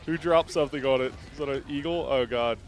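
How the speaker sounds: alarm shout. Real, not acted. Eagle strike chaos.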